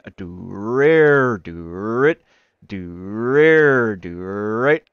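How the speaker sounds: slow vocal bass riff, swooping up and down; imitated bass guitar. Game master mimics the bass man's riff, sung bass noises. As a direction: draw out each note like a twanging bass. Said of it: "the bass starts going".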